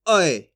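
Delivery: अय said low, with a clear glide from a to y; ai vowel. Shown as a demonstration. Teacher contrasting अय with plain ए, low and clear. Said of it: "इसका तो normal उच्चारण है ए और इसका".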